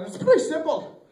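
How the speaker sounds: high-pitched joke leprechaun. Acted. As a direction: play the little leprechaun laying out terms.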